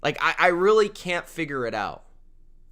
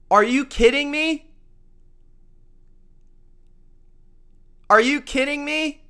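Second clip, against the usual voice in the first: disbelief; 8 semitones higher than usual. Real, not acted. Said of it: "didn't practice, guys".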